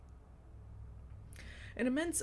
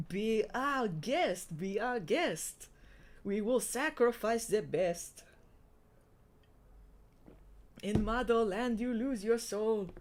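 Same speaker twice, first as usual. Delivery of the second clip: mock French accent; 2 semitones higher than usual. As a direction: croon it like a hammy French maître d'. French creature parody of a show tune.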